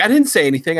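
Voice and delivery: high, loud mock protest; indignant denial. Wife denying her annoyance to husband, high mock indignation.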